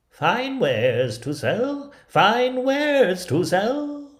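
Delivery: high, loud, slow sing-song hawker call; an old peddler woman drawing out her cry; peddler crying wares. Acted. Disguised queen calling at the dwarfs' door, high sing-song. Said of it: "she knocked at the door and cried".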